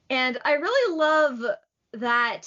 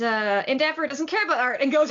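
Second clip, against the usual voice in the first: ordinary talk, nothing marked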